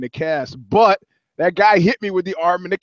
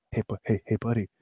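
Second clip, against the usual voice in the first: softer, lower hushed call; lurking man's whisper; 7 semitones lower, 10 dB quieter, faster than usual. Retold or imitated. Homeless man at Safeway softly calling, hushed. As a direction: hiss buddy from the shadows. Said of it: "He goes".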